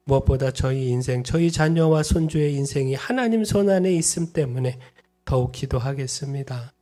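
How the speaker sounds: earnest spoken prayer for the congregation; pastor's benediction prayer; closing prayer. Acted. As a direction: pray it steadily on their behalf.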